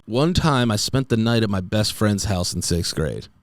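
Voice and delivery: normal talking voice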